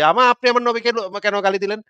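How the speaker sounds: mock yell of a believer taking offence; mock outraged shout. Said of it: "চিৎকার করে ওঠেন".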